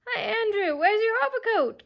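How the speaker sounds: hoog en luid als een deftige dame; high windy Miss Lark. A narrator's reading. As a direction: mimic a fussy lady's high windy voice. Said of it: "trying to make a high, windy voice like Miss Lark's".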